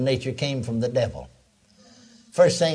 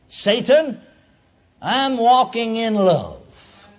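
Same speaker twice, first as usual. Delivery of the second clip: higher, slow and loud defiance; defiant declaration; 7 semitones higher, 4 dB louder, much slower than usual. Pretend. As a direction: stand firm and tell Satan off. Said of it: "speaking to Satan".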